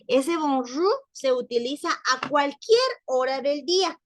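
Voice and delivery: ordinary delivery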